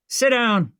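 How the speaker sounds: understated thug yell; mild yell. Acted. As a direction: bark at her to sit. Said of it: "one of the thugs yelled".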